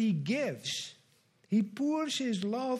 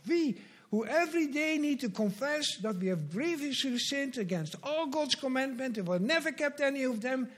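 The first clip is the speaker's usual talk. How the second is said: ordinary talk, nothing marked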